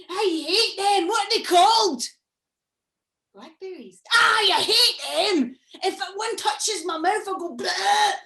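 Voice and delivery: high, loud squealing monster voice, horrified; a monster shrieking about what it hates; disgusted monster. Acted. Monster tells Granny its fears.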